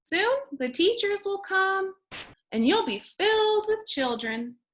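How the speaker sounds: lightly voiced janitor. A narrator's reading. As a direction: reassure the school brightly. Janitor continues speaking.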